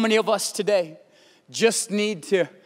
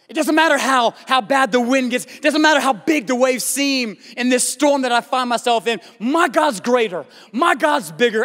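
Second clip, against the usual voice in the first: raised, driving sermon delivery; impassioned preaching; 4 semitones higher than usual. Acted. Preacher to congregation on peace in God's presence, impassioned preaching. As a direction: preach it fervently, phrase upon phrase.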